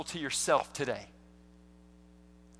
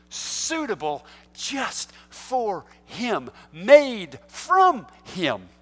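slow emphatic; 3 semitones higher, much slower than usual. Real, not acted. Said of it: "sermon emphasis".

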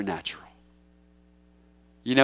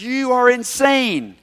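Festus scoffing; 8 semitones higher, slower than usual. Acted. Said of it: "He says".

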